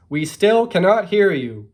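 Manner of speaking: high, loud, drawn-out holler; big storybook long-distance call; shouting back. A narrator's reading. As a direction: yell slowly across a distance. Mole yells back from afar.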